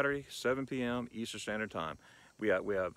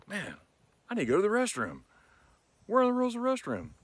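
higher, urgent mock plea; desperate diner; 10 semitones higher than usual. Mock diner searching for the restroom, higher. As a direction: play someone who needs the toilet now. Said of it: "you're like".

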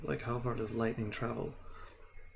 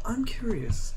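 high curious; 11 semitones higher, 4 dB louder, slower than usual. Acted. Opening a silly question.

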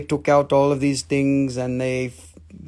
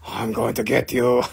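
demon voice; 4 semitones lower than usual. Pretend. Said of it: "demons coming out and saying".

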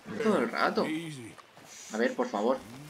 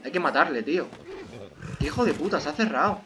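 ordinary talk, nothing marked

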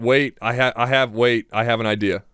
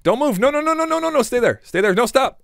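panicked pleading; 10 semitones higher than usual. Real, not acted.

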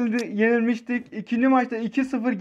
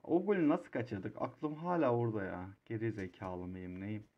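self-reproach; 13 semitones lower, 14 dB quieter than usual. Real, not acted. Berating himself over missed goal.

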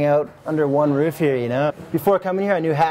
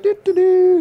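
high, loud carefree "doo doo doo"; mock carefree humming; 5 dB louder, slower than usual. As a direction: hum it like nothing scares him. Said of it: "And he's just like".

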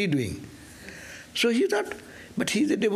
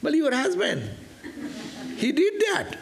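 high, laughing answer; a playful boy laughing as he tells on the husband; 11 semitones higher than usual. Retold or imitated. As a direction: laugh it out like a cheeky child.

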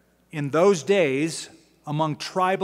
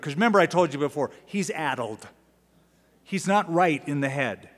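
ordinary delivery

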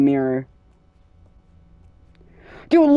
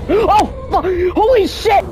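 scared shout; 13 semitones higher, slower than usual. Real, not acted. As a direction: gasp then high panicked yell. Sudden scare in horror game.

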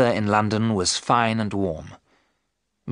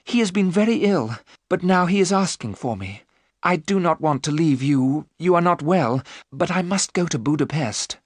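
put-on higher-pitched, earnest young wife-to-be; earnest Mina; 7 semitones higher than usual. A narrator's reading. Mina explains to Lucy she must leave, high and earnest. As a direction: voice Mina high and urgent, pleading to be understood. Mina's speech to Lucy in story.

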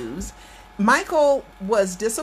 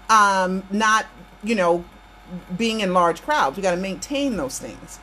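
ordinary delivery

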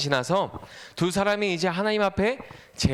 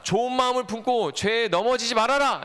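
higher-pitched, urgent admonition; emphatic acted command; God's warning; 6 semitones higher than usual. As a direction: warn firmly as God speaking to Cain. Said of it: "경고의 말씀을 주셨어요".

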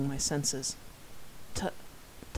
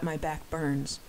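usual speaking voice

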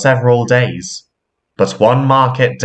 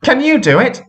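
eager; 13 semitones higher, faster than usual. Acted. Controller asks eagerly.

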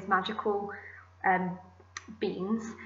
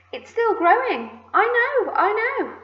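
very high, loud squeals; excited shouting; 13 semitones higher, 5 dB louder than usual. A narrator's reading. Aunts watching the peach grow, excited squealing. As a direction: squeal with giddy delight. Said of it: "silly things in their excitement".

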